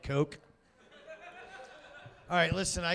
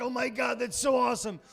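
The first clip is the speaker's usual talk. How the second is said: delighted; 7 semitones higher, 6 dB louder than usual. Retold or imitated. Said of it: "I was like".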